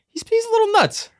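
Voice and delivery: very high falsetto aside; high-pitched teasing. Real, not acted. Host jokes about the unhinged pitcher, in teasing falsetto. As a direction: squeak it up high, jokingly.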